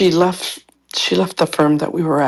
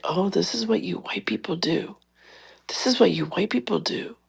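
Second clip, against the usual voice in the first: hushed, softer retelling of a confidence; imitated confiding aside; 3 semitones higher, 8 dB quieter than usual. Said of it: "the way she was sharing it with me".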